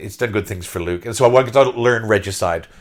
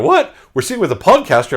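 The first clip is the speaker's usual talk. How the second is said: put-on kids' disbelief; 8 semitones higher, 7 dB louder than usual. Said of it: "These two kids were like".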